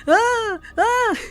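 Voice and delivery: short, high, loud yelps of fake alarm; imitating a silly scream; silly mock scream. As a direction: squeal it like a poked friend, playful not scared. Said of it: "It was like a silly scream".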